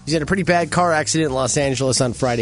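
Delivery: ordinary talk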